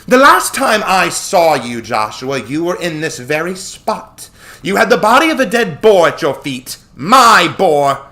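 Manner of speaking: high, loud, slow imperious indictment; angry accusation. A narrator's reading. Artemis confronts Joshua over dead boar, angry accusation. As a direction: accuse him with drawn-out, mounting outrage.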